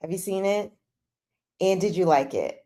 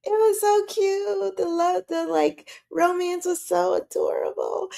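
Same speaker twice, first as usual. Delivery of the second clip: syrupy mock gushing; gushing fans; 12 semitones higher than usual. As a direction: imitate swooning fans in a high voice. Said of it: "people who are like".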